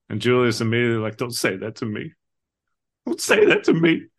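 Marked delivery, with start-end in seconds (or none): 3.1-4.1 retold anguished outburst, high and loud; super emotional. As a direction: act out a distraught plea, pitched way up. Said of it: "he just gets super emotional".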